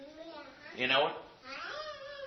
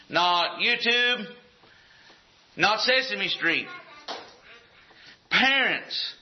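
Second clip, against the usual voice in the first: emphatic preaching; 4 semitones higher, slower than usual. Acted. Preaching a list of nots.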